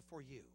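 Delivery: "for you" set apart and weighted; emphasis on "for you". Shown as a demonstration. Preacher stressing the key words of God's message, emphatic. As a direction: lean on "for you". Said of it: "Now, that was important, the".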